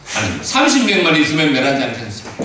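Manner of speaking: high, loud, earnest plea; pleading Abraham. Acted. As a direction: beg God for the city.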